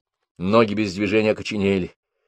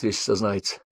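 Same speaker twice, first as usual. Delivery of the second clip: ordinary delivery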